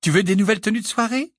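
high, quick doting mother; a fussing mum offering treats; mother speaking to her son, high and fast; lightly voiced mother. A narrator's reading. Mrs Weasley voiced high.

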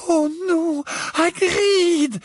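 high, whiny dismay; moaning in dismay. A narrator's reading. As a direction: wail her worry. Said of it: "gémit Hermione".

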